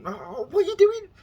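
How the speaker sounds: high, loud disbelieving yell; exasperated shout at screen. Retold or imitated. Viewer yells at zombie show character in loud high disbelief. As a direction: shriek at the dumb character on TV. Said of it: "I've gone".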